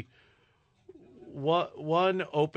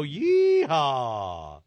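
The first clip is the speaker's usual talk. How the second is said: long, drawn-out cowboy yeehaw; whooping game-show rodeo call; rodeo holler. Pretend. Rodeo catchphrase hollered by host.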